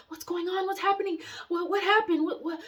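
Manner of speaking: high-pitched panicked questions, reenacted; retold screaming. Telling how she screamed over the bloody bathtub, retold panic. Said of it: "So I'm screaming".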